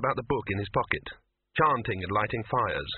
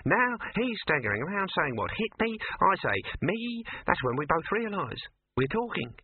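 higher pitched animal character; lightly voiced animal storyteller; 9 semitones higher than usual. Talking animal recounts discovering speech, lightly voiced creature.

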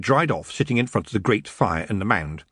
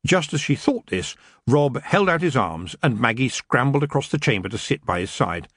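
ordinary delivery

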